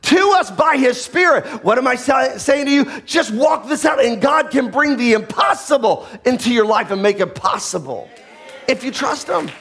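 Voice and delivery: high, driving preacher's proclamation; impassioned, high-pitched sermon delivery; full-force preaching. Acted.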